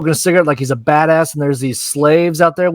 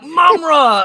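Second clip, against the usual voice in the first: high, hammed-up battle cry; mock villain cry; 11 semitones higher than usual. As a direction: call it out like a cartoon villain. Repeating his excited villain cry.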